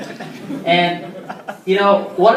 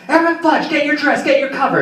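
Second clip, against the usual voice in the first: higher and louder, barking like a drill sergeant; angry drill sergeant; 8 semitones higher, 3 dB louder than usual. Retold or imitated. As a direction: chew out the airman over a crooked ribbon rack. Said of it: "this angry sergeant yelling in my head".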